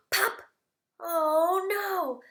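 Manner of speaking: high gasp, then oh no; surprised gasp. Acted. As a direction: act shocked for the kids. Last button pops.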